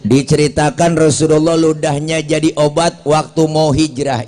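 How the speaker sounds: normal talking voice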